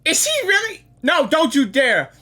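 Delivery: normal talking voice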